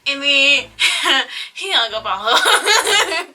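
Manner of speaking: laughing through the words, slow. Real, not acted. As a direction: giggle it out. Laughing while telling the punchline.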